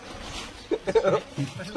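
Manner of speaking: amused chuckling laugh; chuckle, amused that it is over. Real, not acted. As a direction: laugh before speaking. Laughs at questions running out.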